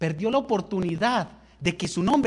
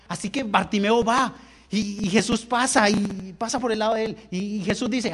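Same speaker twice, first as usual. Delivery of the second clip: ordinary talk, nothing marked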